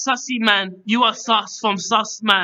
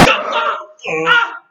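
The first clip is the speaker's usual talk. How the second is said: mock scream; 8 semitones higher than usual. Host screams at fake gun.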